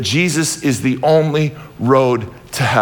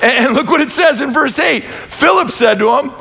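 fiery preaching; 8 semitones higher than usual. Acted. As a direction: preach it out to the whole church, full force. Preaching at full force.

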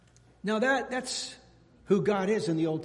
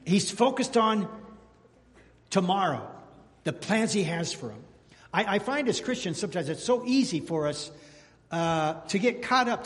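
ordinary delivery

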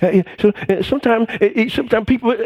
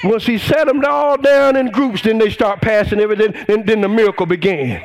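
preaching at full force; 5 semitones higher than usual. Acted. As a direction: whoop it up like a revival preacher.

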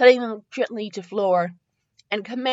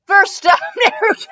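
high, loud, breaking into laughter; real laughing; 11 semitones higher, 8 dB louder than usual. Real, not acted. Reader losing it over the bad writing, laughing. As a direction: crack up while trying to read. Reader cracks up mid-sentence.

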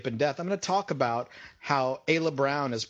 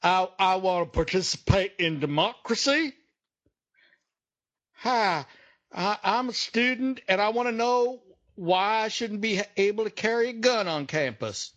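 higher, halting earnest voice; hammed-up audience questioner; mock student questioner; 5 semitones higher than usual. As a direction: stammer a question like a nervous student.

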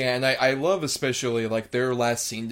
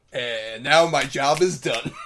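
laughing gloat; 2 semitones higher, 5 dB louder, slower than usual. Real, not acted. Co-host gloats after winning point.

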